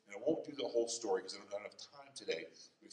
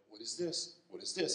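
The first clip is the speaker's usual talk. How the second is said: usual speaking voice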